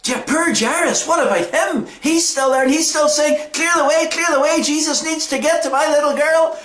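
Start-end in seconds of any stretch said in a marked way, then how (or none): 3.5-6.6 higher-pitched acted plea of a frantic father; upset, urgent plea. Desperate father shouting for a way to his dying daughter, acted urgent plea. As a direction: push through urgently, voiced for a children's story. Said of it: "because he's so upset".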